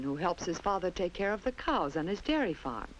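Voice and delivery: plain reading voice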